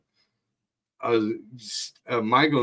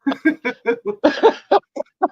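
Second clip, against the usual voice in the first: helpless high laugh; real wheezing laughter; 5 dB louder than usual. Real, not acted. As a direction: laugh until it squeaks. Cracking up at the story.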